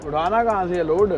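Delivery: ordinary talk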